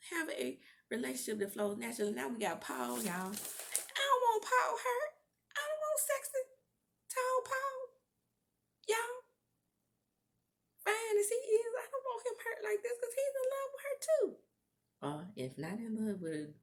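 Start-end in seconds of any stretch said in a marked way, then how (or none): 3.3-14.4 very high, squeaky lament; hammed-up wail in a tiny high voice; mock whining. Comic lament for Paul.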